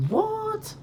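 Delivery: high squeaked what; mock outrage. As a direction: squeak it in fake indignation. Mock outrage after pasta line.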